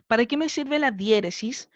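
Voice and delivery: ordinary talk, nothing marked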